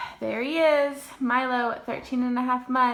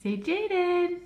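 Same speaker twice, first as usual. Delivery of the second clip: high coaxing; 10 semitones higher, 4 dB louder, slower than usual. Real, not acted. Mom coaxing toddler to talk.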